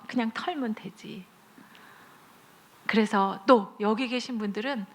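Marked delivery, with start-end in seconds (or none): 0.1-1.2 soft whisper, just brush it off. Real, not acted. Preacher whispering comfort to the congregation. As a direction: breathe it like a secret.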